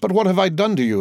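high, desperate plea; frantic exclaim. A narrator's reading. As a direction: pitch it up in near madness. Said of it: "exclaimed Villefort".

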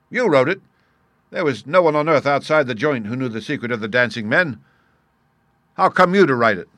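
loud, high-pitched disbelief; incredulous. Acted. Criminal astonished by Holmes's admission.

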